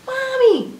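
high, loud call for mom; excited shout. A narrator's reading. Young rabbit calls his mother to see spring, excited shout. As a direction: call out like a thrilled child. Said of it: "he shouted".

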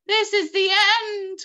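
high, loud cry of doom. A narrator's reading. Old grasshopper as the peach falls, crying out. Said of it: "cried the old green grasshopper".

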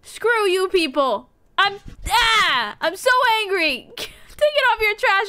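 high-pitched exasperated outburst; so angry. Real, not acted. Player to townspeople who attacked, high angry outburst. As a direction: rage at them with comic fury.

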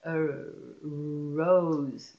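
"rose" with a long held r; slow sounding-out of "rose"; stretched sounds. Shown as a demonstration. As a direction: draw out the r into "rose". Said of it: "Those are stretchable sounds".

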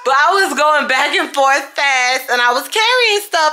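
loud, high-pitched excuse-making; defensive protest. Real, not acted. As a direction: plead your case at full volume while being laughed at. Defends being tired while he laughs.

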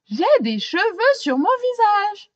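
high, loud indignant cry; loud complaint. Acted. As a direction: protest about his face. Policeman complains.

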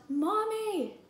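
put-on high call for mom, child's voice; yelling. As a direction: shout for mommy to come help. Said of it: "She yelled".

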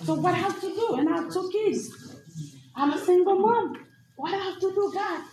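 high, helpless appeal to God; retold plea. Single mom of two asks God what to do, pleading. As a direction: plead upward as you retell it. Retold prayer of a worried mother.

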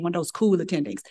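ordinary talk

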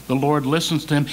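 usual speaking voice

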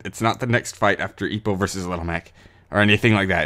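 ordinary delivery

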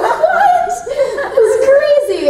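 very high laughing what; laughing disbelief. Retold or imitated. Retelling her laughing reaction, very high. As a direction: squeal it through laughter. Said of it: "I'm like".

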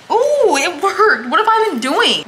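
high, loud, slow squeal of delight; thrilled that the gadget worked; drawn-out excited ooh; delighted surprise. Real, not acted. Squeezer works, delighted surprise.

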